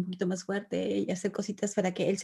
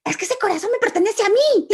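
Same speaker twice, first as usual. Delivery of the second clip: put-on high, loud, laughing child's protest; indignant little boy; 12 semitones higher, 8 dB louder than usual. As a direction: play the outraged kid. Niño replica indignado. (niño indignado defendiendo su corazón, voz alta)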